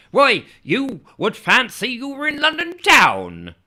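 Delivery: high, loud proud townsman voice; boasting local man, voiced up high. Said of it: "he said".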